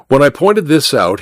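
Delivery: usual speaking voice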